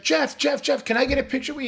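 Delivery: put-on high, fast excited kid begging; eager kid. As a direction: plead like a starstruck boy, quick and high. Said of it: "and he's like".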